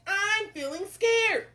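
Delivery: high, slow mock wail; scared cook. Cook is asked to chop, hammed-up fright. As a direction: draw it out in cartoon fear.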